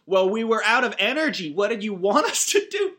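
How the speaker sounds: mock defensive councilman. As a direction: play the councilman as a petulant excuse-maker. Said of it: "this one guy goes".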